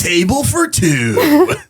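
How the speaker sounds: YouTuber voice. Pretend. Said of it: "YouTuber voice doing daily activity things".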